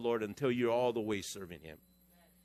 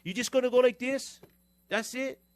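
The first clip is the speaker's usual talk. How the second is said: high, loud taunting questions; mocking challenge; 10 semitones higher, 4 dB louder than usual. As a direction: goad him with scornful disbelief. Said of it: "He said".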